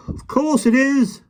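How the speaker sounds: high, slow scornful drawl; scoffing. A narrator's reading. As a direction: sneer it out slowly. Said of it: "scoffed the park keeper".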